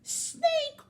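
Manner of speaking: very high, loud wail; frantic wolf realizing the betrayal; horrified disbelief. Acted. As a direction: cry out aghast, dragging the last words. Wolf realizes chickens were eaten.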